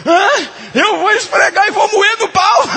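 high, loud comic outburst; hammed-up retort. Preacher retelling his own reply to his wife, loud comic shout. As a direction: shout it back like a joke at the masseur. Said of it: "Eu falei".

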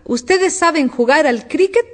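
high, slow shouted question; bellowed. A narrator's reading. Queen asks about cricket, bellowing. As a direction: call it out high, drawing it out. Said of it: "vociferó la reina".

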